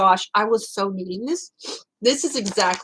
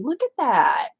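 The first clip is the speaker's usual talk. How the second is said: normal talking voice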